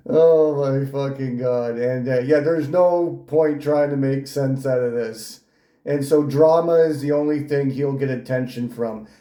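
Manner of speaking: ordinary delivery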